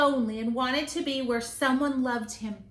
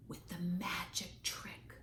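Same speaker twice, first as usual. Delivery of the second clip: secret whisper; 5 semitones lower, 14 dB quieter than usual. A narrator's reading. Parent reading bedtime story, hushed whisper. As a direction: breathe it like a secret.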